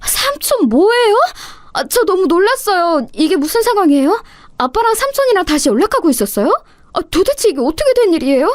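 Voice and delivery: bursting with confusion; astonished. Acted. Daughter astonished to see the uncle with her father.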